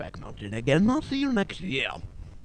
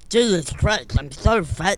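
loud mock complaint; 7 semitones higher, 11 dB louder, slower than usual. Character complains loudly.

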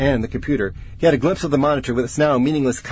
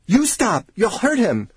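high, distressed protest; tearful plea; 9 semitones higher than usual. A narrator's reading. Weeping colleague pleading with the researcher, distressed. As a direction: beg him to stop, upset. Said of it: "She was weeping".

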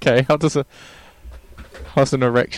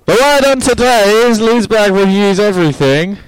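high, loud shout for a deaf listener; 11 semitones higher, 9 dB louder than usual. Acted. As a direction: bellow it so they finally hear. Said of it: "That's not any louder!".

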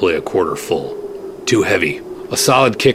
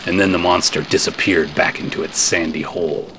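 usual speaking voice

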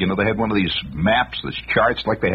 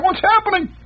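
mock scream; 3 dB louder than usual.

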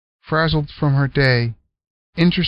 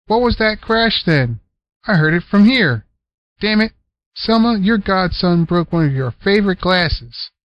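teasing uncle; 6 semitones higher than usual. Acted. Unc taunting, tattling.

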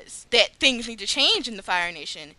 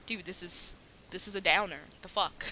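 much softer, unimpressed grumble; bored muttering; 4 semitones lower, 12 dB quieter than usual. Retold or imitated. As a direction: mutter it under your breath like a sulky teen. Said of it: "she's just like".

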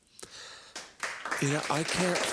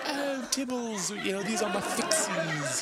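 Russell Brand impression; 8 semitones higher than usual. Russell Brand proudly showing off his fixies, high laughing impression. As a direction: show off the bikes with a giggly flourish. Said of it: "he's like".

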